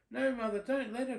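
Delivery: high, loud pleading whine; whiny cry. Acted. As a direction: beg mother not to allow it. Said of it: "cried the stepsisters".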